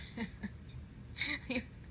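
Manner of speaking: breathy, amused chuckle; laugh. Real, not acted. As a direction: chuckle at your own story. Laughing at her own story.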